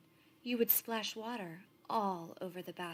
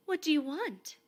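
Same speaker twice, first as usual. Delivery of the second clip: much higher, louder frightened question; startled Laszlo; 8 semitones higher, 9 dB louder than usual. A narrator's reading. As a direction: blurt it out, startled in the night. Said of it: "asked Laszlo".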